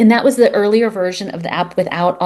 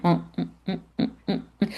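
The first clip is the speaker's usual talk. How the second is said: softer closed-mouth munching hums after a chomp; Pac-Man munching hum; 9 dB quieter, faster than usual. Retold or imitated. Dad imagining Pac-Man eating cancer, soft munching hums. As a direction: hum like something gobbling up dots. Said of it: "He even made the sound".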